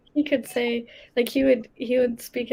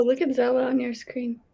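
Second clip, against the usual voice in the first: ordinary talk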